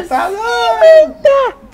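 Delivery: shout. Acted. Mock outrage during game.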